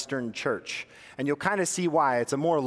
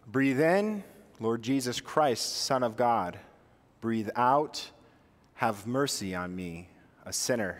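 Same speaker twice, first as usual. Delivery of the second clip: guided breath prayer; 5 semitones lower, slower than usual. Shown as a demonstration. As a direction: lead them through a breathing prayer, unhurried. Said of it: "your voice in your head for now".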